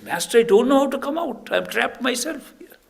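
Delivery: high, loud cry for help; acted panicked plea. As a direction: plead to the master as if trapped. Trapped disciple pleads with master.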